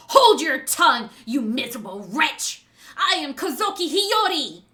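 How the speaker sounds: loud, furious proclamation; angry tears. Acted. Hiyori silencing Orochi through angry tears, furious. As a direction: burst out loud and indignant. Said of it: "these angry tears".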